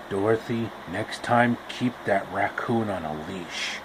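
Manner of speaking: low, soft stern uncle; deep hushed warning to Dorothy; Uncle Henry scolding. Acted.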